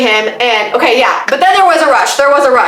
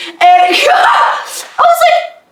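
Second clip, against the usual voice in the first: laughing; much slower than usual. Real, not acted. Laughs retelling her frustration.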